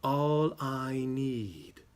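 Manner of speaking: very slow, drawn-out mystic voice; fortune-teller easing into the fee; psychic naming his price. Acted. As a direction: stretch the words out theatrically.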